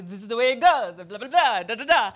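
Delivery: loud, dismissive French guy; mock French sound mixer. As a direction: brush it off like a know-it-all French mixer. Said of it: "a typical French guy".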